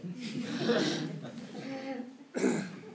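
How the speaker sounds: amused laugh breaking the reading; real laugh. Real, not acted. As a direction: burst of laughter at his own joke. Laughs at his own joke.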